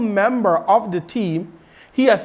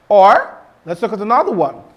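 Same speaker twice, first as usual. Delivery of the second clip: normal talking voice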